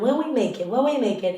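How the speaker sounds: imitating a friend's unfussy certainty; matter-of-fact. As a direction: say it quietly as a simple given. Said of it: "so matter of fact".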